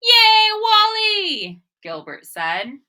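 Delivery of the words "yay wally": high, slow, drawn-out cheer. Acted. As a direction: cheer for a friend. Gilbert cheers Wally.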